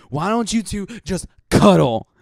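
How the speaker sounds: snapping. Retold or imitated. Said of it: "So I finally snapped".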